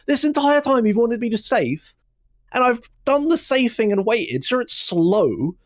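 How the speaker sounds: high, slow, pleading complaint; exasperated protest. Retold or imitated. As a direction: argue back in disbelief, high and drawn out. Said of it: "I've just sat there just like".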